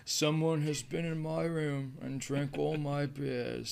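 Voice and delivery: slow, slurred rock-star mumble; [name] voice. Retold or imitated. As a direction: drawl it like a dazed Ozzy. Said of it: "channeling his inner [name]".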